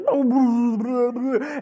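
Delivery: loud, messy mock bawling; imitated blubbering cry. Preacher imitating his crying son on the top bunk, loud blubbering. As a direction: blubber like a child being sick. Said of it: "he's crying, and, like".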